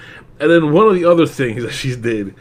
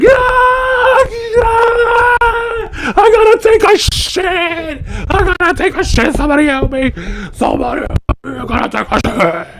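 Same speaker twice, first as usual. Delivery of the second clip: much higher, loud panicked screaming; freaking out; 7 dB louder than usual. Pretend. Picturing himself losing oxygen underwater, screaming in panic. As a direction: scream and beg in comic panic. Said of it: "I would be bugging the fuck out".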